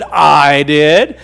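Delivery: proud turtle. Acted. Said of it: "And the turtle said".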